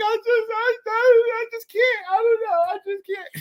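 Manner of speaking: very high, broken tearful squeal; hammed-up breakdown, stammering through sobs; mock sobbing. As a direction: imitate helpless crying, fading at the end. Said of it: "I burst out crying".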